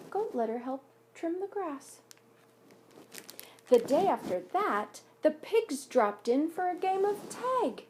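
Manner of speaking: put-on gentle read-aloud voice for children; story narration. A narrator's reading. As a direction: read it like a picture book at story time. Reads picture book aloud.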